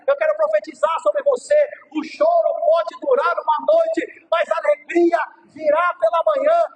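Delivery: loud, building preacher's cry; preaching at full force. Acted. Pastor preaching to mothers on Mother's Day, full force. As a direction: proclaim it to the whole church.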